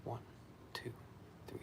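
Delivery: ordinary talk, nothing marked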